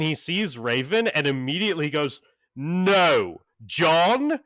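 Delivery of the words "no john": put-on older British voice, high and loud, flatly refusing; loud refusal. Jason loudly refuses John in the library. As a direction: shout no at an old friend.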